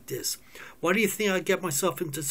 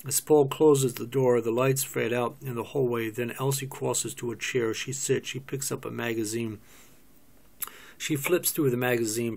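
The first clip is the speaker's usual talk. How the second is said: normal talking voice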